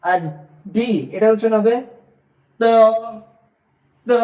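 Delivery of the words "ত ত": ত halfway between দ and ত; drawn-out ত, tongue on upper teeth. Shown as a demonstration. Teacher demonstrating pinyin D, ত between দ and ত. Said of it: "দ আর তর মাঝখানে".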